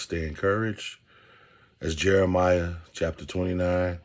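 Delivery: ordinary talk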